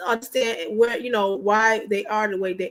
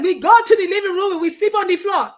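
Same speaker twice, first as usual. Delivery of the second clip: louder, higher, laughing husband impression; husband's voice; 6 semitones higher, 5 dB louder than usual. Wife imitating her frustrated husband's order, laughing. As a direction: play him fed up but laughing.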